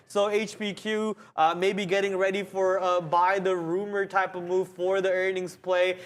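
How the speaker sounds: ordinary talk, nothing marked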